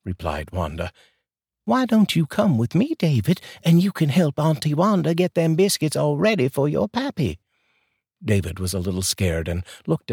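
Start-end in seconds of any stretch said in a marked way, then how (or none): 1.6-7.4 high, folksy Southern neighbor voice; a chatty Southern lady offering coffee; sweet southern drawl. Acted. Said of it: "that sweet southern drawl".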